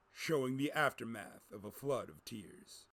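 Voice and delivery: ordinary delivery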